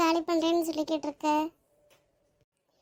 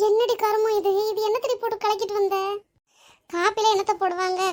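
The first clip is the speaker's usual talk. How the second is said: loud, higher-pitched disgusted scolding; disgusted outburst; 3 semitones higher, 3 dB louder than usual. Acted. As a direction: demand to know what was mixed in. Disgusted after tasting bad coffee. (அருவருப்புடன் திட்டுதல்)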